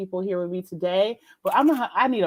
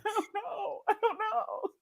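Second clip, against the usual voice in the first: high, soft, helpless squeak; 11 semitones higher, 10 dB quieter than usual. Retold or imitated. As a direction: play the helpless little voice. Answering fiancé's "why are you like this.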